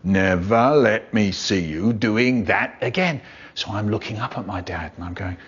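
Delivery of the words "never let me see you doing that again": slow, wagging-finger dad impression; mock stern dad. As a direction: drag it out like a telling-off father. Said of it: "my dad is telling me off".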